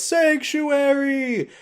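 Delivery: high, drawn-out imitation of a cry; mock yell. Quasimodo holding her up atop the cathedral, in a mock yell. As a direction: proclaim it long and high like a film hero. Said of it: "his head and yells".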